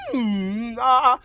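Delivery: high, loud hum opening to "ah"; vocal warm-up. Retold or imitated. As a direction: test the voice on hmm and ah. Demonstrates voice warm-up sounds.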